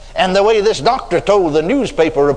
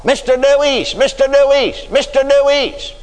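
hollering a name; 8 semitones higher than usual. Retold or imitated. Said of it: "somebody hollering".

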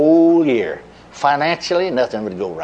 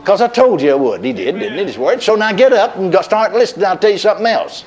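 impatient Lord; 6 semitones higher than usual. Retold or imitated. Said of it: "he'd be saying the same thing".

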